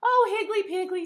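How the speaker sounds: loud, high exclamation with a sigh; a big high sighing cry; sighing old teacher. A narrator's reading. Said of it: "sighed Missus McBloom".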